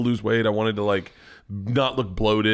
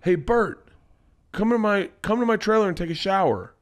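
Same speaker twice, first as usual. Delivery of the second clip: higher-pitched mock yell at ordinary volume; imitated calling over; 6 semitones higher than usual. Snoop invites comedian to his trailer, imitated calling over. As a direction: call across to a friend, lightly acted. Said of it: "yells over".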